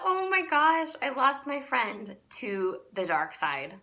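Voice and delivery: high, loud mock horror at losing her friend; shocked dismay. Telling viewers her reaction to the friend's pitch, mock horrified and loud. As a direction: gasp it out like the friend just fell to the dark side.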